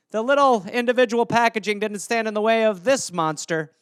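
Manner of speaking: normal talking voice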